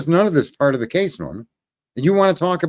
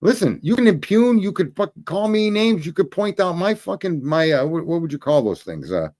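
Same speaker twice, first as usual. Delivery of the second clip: ordinary talk, nothing marked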